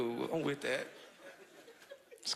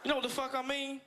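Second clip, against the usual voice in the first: normal talking voice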